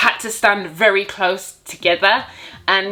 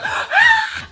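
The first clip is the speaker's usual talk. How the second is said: giddy squeal. Retold or imitated. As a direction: squeal with delight. Inner excitement.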